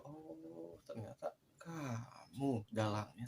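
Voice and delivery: long high "oh", then drawn-out words; retold dawning realization, stretched out; knowing realization. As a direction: slowly reveal you've caught the culprit.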